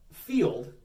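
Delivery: usual speaking voice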